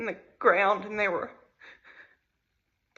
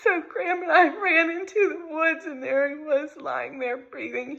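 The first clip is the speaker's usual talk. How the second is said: crying; 5 semitones higher than usual. Real, not acted. Mother retelling her son's rescue while crying. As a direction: push through the tears.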